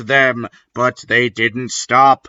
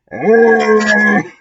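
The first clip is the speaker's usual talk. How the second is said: high loud evil cackle; villain cackle; 9 semitones higher, 4 dB louder than usual. Acted. The villain flies off with a high evil cackle. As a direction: cackle away as you leave. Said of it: "flew away cackling".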